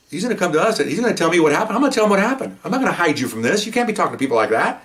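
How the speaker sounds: acting out laying down the law; exasperated scolding. Officer warns his rude partner, retold exasperated scolding.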